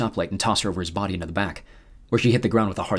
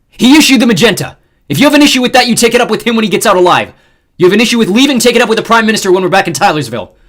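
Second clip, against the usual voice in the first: loud, high angry rant; furious shouting; 13 semitones higher, 14 dB louder than usual. A narrator's reading. Xander shouting down the crew, furious. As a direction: lay down the law at full volume.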